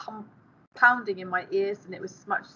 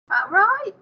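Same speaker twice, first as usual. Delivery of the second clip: high, louder questioning lift; impressed; 11 semitones higher, 5 dB louder than usual. A narrator's reading. Girl impressed by a stranger's claim, impressed questioning. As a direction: sound impressed, voice leaping up. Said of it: "She sounded suitably impressed".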